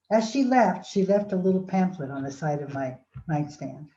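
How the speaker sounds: ordinary talk, nothing marked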